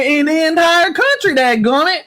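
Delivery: high, twangy yokel put-on; hillbilly interrogator. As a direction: grill them like an exasperated country bumpkin. Mock hillbilly host argues.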